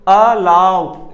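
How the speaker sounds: Allow with LAU pushed loud and long; teacher demonstrating stressed LAU in allow; stress on second syllable of alow. Shown as a demonstration. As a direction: say allow, hitting लाउ hard and stretching it. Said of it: "लाउ हमने जोर देके बोला".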